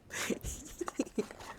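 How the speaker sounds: high breathy giggling; real giggle. Real, not acted. Streamer amused by Katie's comment, high giggle. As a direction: giggle at the retort.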